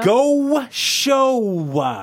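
"go show" with hugely stretched o vowels; exaggerated Canadian. Teasing the host over his intro, exaggerated Canadian. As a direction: drag out both o's in "go show" in mock Canadian. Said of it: "see how gaucho I said it".

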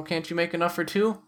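ordinary talk, nothing marked

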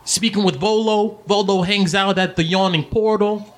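high-pitched friend reporting gossip; Sly, teasing. Acted. Sly showing Domino the broadsheet, teasing. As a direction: read it out high, needling him.

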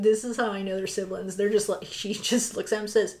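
normal talking voice

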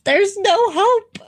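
mock despair. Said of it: "so I'm still depressed".

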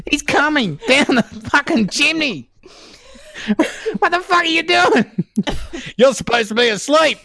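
high-pitched, hammed-up indignation; mock outrage. Santa and sleepless kid swearing at each other, mock outrage. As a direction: squawk it as a comic confrontation. Acts out catching Santa at chimney.

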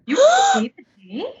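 gasp, then high shocked question. Real, not acted. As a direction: gasp in disbelief.

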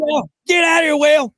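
high, loud shout at the whale; yelling. Pretend. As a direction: bellow it out.

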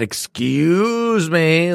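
high, slow, whiny excuse me; mocking customer. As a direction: drag out a prissy excuse me. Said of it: "Some bitch said".

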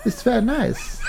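high put-on German accent. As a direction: play a pleased German with a treat. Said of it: "very good German accent".

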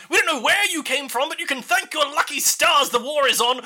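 high, stern official warning; stern envoy. Acted. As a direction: deliver a harsh ultimatum from on high.

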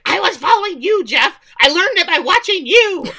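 high, loud melodramatic accusation; hammed-up accusing shout; mock outburst. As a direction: yell it like an overblown confession. Joke confession yelled at husband.

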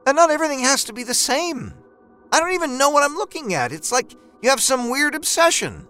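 exasperated wife. A narrator's reading. Wife mocking his obsession, high exasperated. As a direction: throw it back at him. Wife's retort.

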